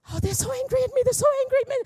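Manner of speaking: anxious fretting. Retold or imitated. Pastor mimicking her own panic at angry drivers, high and fretful. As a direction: squeak it out like a rattled worrier.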